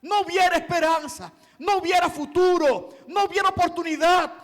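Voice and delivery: loud, high preaching that swells to shouts, punching each no; impassioned preaching. Acted. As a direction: declare it with mounting fervor. Preaching at full force.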